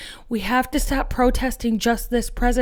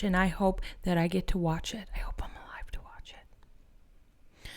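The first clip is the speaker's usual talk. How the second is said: breathy whispered vow, quieter; bitter whisper; 3 semitones lower, 10 dB quieter than usual. Real, not acted. Vlogger wishing punishment on the National Guard, whispered. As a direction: hiss it low like a private wish.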